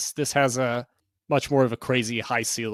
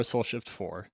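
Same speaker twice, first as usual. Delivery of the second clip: softer echo; 2 semitones lower, 7 dB quieter, slower than usual. Shown as a demonstration. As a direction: repeat Soul Shift four softer, like an echo. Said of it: "I love the Soul Shift four".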